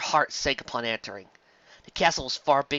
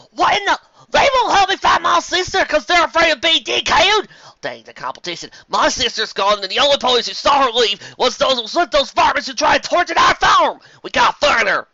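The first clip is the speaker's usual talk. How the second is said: raging shout; 14 semitones higher, 10 dB louder than usual. Acted. Applejack raging about her kidnapped sister.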